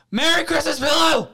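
high, loud yell. Shown as a demonstration. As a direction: yell it out for the game. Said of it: "You gotta yell it".

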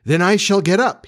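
put-on high, perky boy's voice; eager boy. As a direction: announce it brightly as a young boy. John, roused, eager to get up.

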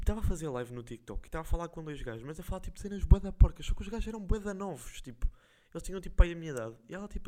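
ordinary delivery

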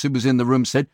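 normal talking voice

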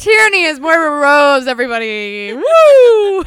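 very loud whooping introduction; cheering announcement. Real, not acted. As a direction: shout it out like presenting a star, ending in a high whoop. Cheering the finished impression.

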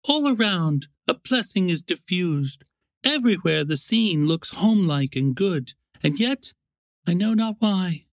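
Summer's wistful wife. Acted. Summer's wife confiding in her husband, wistful.

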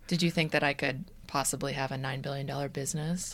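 Elizabeth Holmes. Acted. Host wooing her co-host, put-on deep voice. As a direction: pitch it low, like a founder selling herself. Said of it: "Because I was talking like that?".

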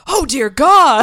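loud, slow, high dismay; aghast exclamation. Retold or imitated. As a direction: groan it out loud and slowly. Fraser aghast after son leaves.

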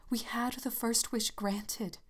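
slow, urgent feverish whisper. Acted. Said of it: "said the old woman feverishly".

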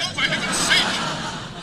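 loud, slow, fed-up cry; exasperated outburst. Acted. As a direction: lose patience completely. Sailor exasperated by delays.

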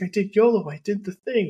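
proud excited guard. Retold or imitated. Guard proudly telling Smith about the major, high and excited. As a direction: announce the prank like a triumph. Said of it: "I was like".

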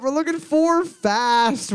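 drawn-out high announcement; slow announcing call. Acted. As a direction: stretch it out like a quiz host.

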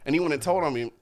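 ordinary talk, nothing marked

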